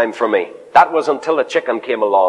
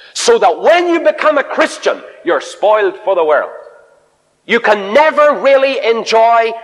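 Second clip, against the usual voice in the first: raised, high, ringing sermon voice; fervent preaching; 7 semitones higher than usual. Acted. Preacher urging the congregation toward new life, fervent preaching. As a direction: preach it out with rising fervour. Preacher building to full force.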